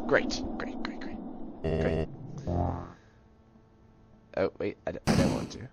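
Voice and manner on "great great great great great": breathless, sarcastic whisper of great, great. Real, not acted. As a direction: hiss the praise bitterly under your breath. Sarcastic muttering after dying.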